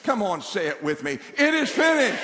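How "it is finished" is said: high, loud rallying declaration. Shown as a demonstration. Preacher leading congregation to say it together, declaring. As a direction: lead the room in declaring it. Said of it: "we need to speak it out loud".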